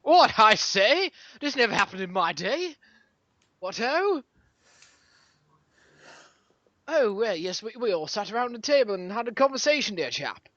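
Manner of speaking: hammed-up posh old chap, swooping pitch; mock old-fashioned gentleman. Host lectures friends on Twitter manners, mock old gentleman. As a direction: play a fusty gent reminiscing about his day. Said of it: "I don't know why I'm talking like this anymore".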